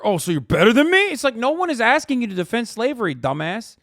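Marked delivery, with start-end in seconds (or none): 0.0-2.4 louder, higher indignant whine; indignant mock retort; mock indignant chatter. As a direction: play the offended chatter, pushing back. Mocking defensive chatter reply.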